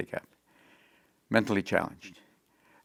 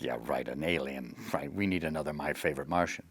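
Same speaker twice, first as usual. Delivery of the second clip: low, soft mock sneer; sneering critics; 6 semitones lower, 10 dB quieter than usual. Press scoffing at the alien show, low and soft mock sneer. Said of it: "pre-articles like".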